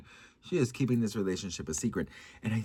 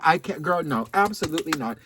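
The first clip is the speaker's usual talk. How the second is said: normal talking voice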